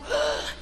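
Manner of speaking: sharp high gasping "Ah" for air; imitated gasp. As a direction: mimic a desperate gasp.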